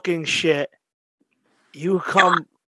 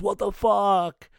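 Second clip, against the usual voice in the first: drawn-out, higher mock outcry; imitated indignant friend; 6 semitones higher, slower than usual. Friend calling about an unannounced show, imitated drawn-out protest. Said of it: "he calls me and goes".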